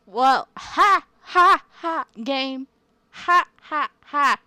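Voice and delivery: loud, high, separate spoken ha's; mocking laugh. Real, not acted. Player taunting the game after a scare, mocking.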